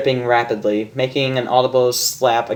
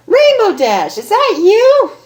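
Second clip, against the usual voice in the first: very high, loud squeal of surprise; gasping surprise; 6 dB louder than usual. Acted. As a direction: gasp out the name in delight. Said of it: "She gasped".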